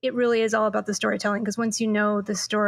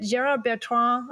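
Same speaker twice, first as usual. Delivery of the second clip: French accent. Shown as a demonstration. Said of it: "I'm terrible French accent there".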